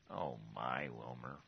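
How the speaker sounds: put-on a gentle, bemused God chiding his child; God's fond voice.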